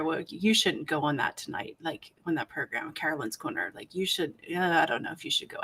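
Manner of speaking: a sly devil's murmur, rushed at the end; mock devil whisper. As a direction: murmur it like a devil in her ear. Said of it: "the Satan was whispering in my ear".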